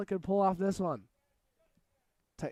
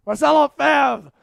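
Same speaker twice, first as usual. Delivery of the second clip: shouting the name at full volume, high and slow; excited shout; 9 semitones higher, 15 dB louder than usual. Real, not acted. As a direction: yell the tackler's name as the hit lands. Live rugby commentary, big tackle.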